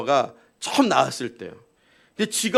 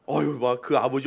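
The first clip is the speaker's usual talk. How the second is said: usual speaking voice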